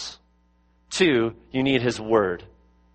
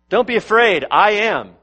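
bold reassurance; 7 semitones higher, 5 dB louder than usual. Retold or imitated. Voicing Jesus in the storm.

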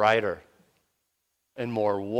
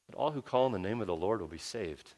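quiet prayerful verse; 8 dB quieter than usual. Shown as a demonstration. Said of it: "cleansed us of our sins".

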